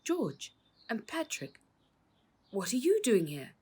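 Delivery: stunned to see them here; shocked. Acted. Uncle confronting the crooked policemen, shocked.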